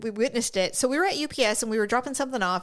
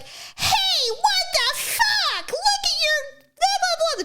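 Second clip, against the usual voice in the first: high, slow, shrill-sounding mock rant; Karen voice; much slower than usual. Karen yelling at a truck driver, high mock rant. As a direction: ham up an entitled woman yelling at a driver. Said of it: "she's like".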